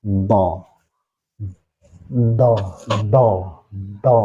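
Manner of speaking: "do" with puffed mouth and tongue a little forward; tongue slightly out. Shown as a demonstration. Teacher showing his kiai's method, tongue-forward puffed "do". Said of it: "agak keluar sedikit".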